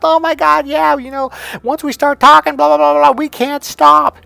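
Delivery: very high, loud mock-female gabble; hammed-up excited chatter; imitated excited women. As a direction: squeal like a salon full of chatty women. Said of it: "they all started laughing".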